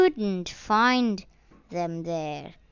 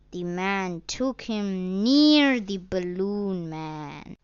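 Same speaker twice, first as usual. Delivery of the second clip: ordinary delivery, nothing marked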